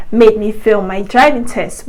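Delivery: ordinary talk, nothing marked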